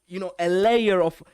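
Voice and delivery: ordinary talk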